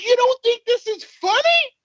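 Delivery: high, slow disbelief; can't believe she isn't laughing; incredulous. Retold or imitated. Asking his wife in disbelief, high and slow. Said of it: "I'm like".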